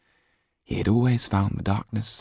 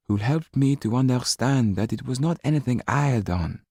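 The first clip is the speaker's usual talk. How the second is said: higher, gentle woman's voice; Mrs Laxton confides; 8 semitones higher than usual. Acted. Mrs Laxton tells Jan of her mother, high and gentle. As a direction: share a painful memory kindly.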